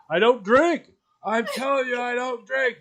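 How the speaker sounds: high, loud protest that he never drinks; insistent denial. Retold or imitated. Stoned driver protests to the cop: high, loud denial. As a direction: plead your innocence loudly to the cop.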